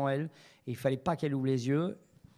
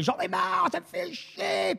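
high-pitched, drawn-out fed-up cry; retold exasperated yell; slower than usual. Actress screaming she has had enough during a shoot, high and slow. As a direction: wail it slowly, pitched way up, totally fed up. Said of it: "et elle hurle".

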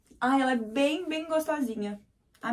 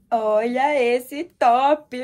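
loud, drawn-out delight; excited exclaim; 8 dB louder, much slower than usual. Real, not acted. Showing her viewers a new top, loud drawn-out excitement. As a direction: show it off like a new treasure.

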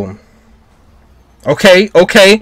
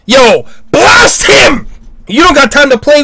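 urging shout; 6 semitones higher than usual. Real, not acted. Viewer urging the hero to blast him, shouting.

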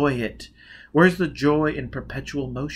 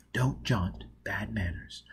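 hushed aside; 3 semitones lower, 8 dB quieter, slower than usual. Acted. Foyle coaching Robin at the ball.

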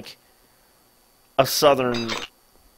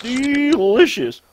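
higher, drawn-out DELICIOUS with relish; relishing character; 4 semitones higher, 4 dB louder, much slower than usual. Acted. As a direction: stretch out DELICIOUS, rising high on the first syllable. Savouring food in character, repeated.

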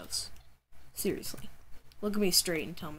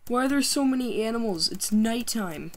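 alarmed; 5 semitones higher, 7 dB louder than usual. Real, not acted. Surrounded by mobs at night.

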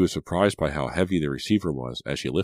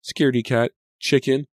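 put-on high, louder, teasing child; lightly voiced mocking little boy; child's taunt; 9 semitones higher, 5 dB louder than usual. A narrator's reading. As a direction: taunt it high like a kid name-calling.

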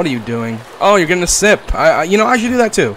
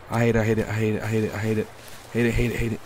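softer and softer chant of dread; fading fearful mutter; 5 semitones lower, 10 dB quieter, faster than usual. Real, not acted. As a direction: mutter it to yourself as it fades. Running scared into the dark.

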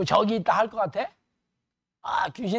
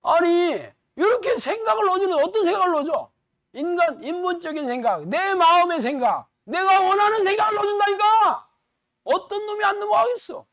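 fiery preaching; 7 semitones higher than usual. Acted. Pastor warning the church about Satan, fiery preaching. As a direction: drive it home like a revival preacher. Preaching builds to full force.

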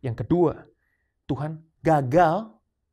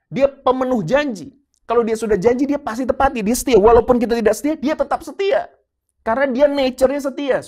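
loud, high, ringing proclamation; louder and higher pulpit climax; preaching at full force; 5 semitones higher, 8 dB louder than usual. Acted. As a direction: preach it out to the whole church.

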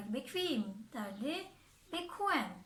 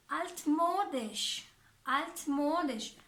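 1 slow teaching demo; 3 dB louder, much slower than usual. Shown as a demonstration. Teacher modelling a new word.

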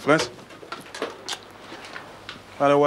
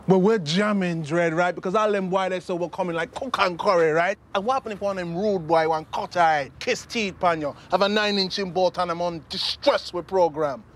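fired-up Jamaican street talk; mocking Jamaican accent; 6 semitones higher than usual. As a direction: rattle off a challenge in heavy Jamaican slang. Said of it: "I can do a Jamaican accent".

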